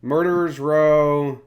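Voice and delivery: ordinary talk, nothing marked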